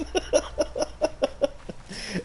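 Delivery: high breathless wheeze of a laugh. Real, not acted. Recalling the phone prank to a friend, wheezing laugh. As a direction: laugh silently till it squeaks.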